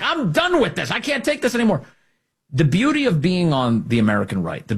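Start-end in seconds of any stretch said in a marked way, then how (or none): 0.0-1.8 mock exasperated outburst. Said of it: "that people are like".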